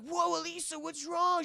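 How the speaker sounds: high, urgent concern; acted alarm. Gargoyle checking on glowing Elisa, acted alarm.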